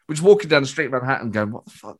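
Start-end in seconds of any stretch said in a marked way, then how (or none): 1.5-2.0 stunned whisper. Retold or imitated. Stunned, walking away.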